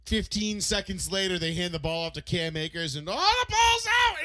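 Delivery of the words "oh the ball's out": high, slow, loud dismay; shocked cry. Retold or imitated. Retelling the fumble to friends, shocked loud cry. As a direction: wail it as the ball pops loose.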